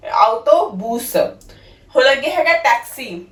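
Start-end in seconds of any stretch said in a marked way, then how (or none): 0.1-1.2 ਆਟੋਬੁਸ drawn out slowly as ow-to-BOOS, rising at the end; slow Italian word. Shown as a demonstration. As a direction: stretch every syllable of ਆਟੋਬੁਸ. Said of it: "ਬੋਲਦੇ ਆ ਬੂਸ".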